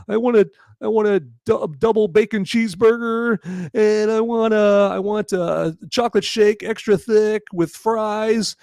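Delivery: high, mock-crying meal order; imitating tearful Edward. Condemned man orders last meal from guard, mock tearful. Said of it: "and he's crying".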